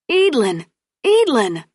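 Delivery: calling a name from afar. Acted. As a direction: call out across the lawn. Mother calling from window.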